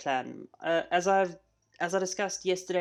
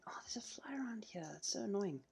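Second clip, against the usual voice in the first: whispering; 6 semitones higher, 9 dB quieter than usual. Real, not acted. Annoyed aside about a fly.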